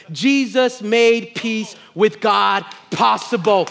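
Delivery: ordinary talk, nothing marked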